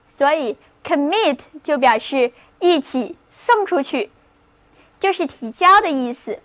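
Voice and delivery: usual speaking voice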